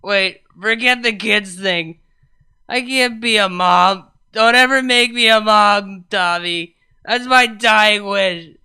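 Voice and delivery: put-on loud, sloppy self-pitying girlfriend; drunk weepy rant. Vinyl on voicemail berating herself, loud drunk weeping. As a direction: blubber it loudly like a wasted apology.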